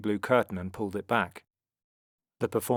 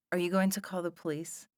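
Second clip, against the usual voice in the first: high, small worried voice; anxious question; 9 semitones higher than usual. A narrator's reading. Sarah fears the police, asking in anxious high voice. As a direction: ask it timidly, pitched up. Sarah's stomach dropped, she asks.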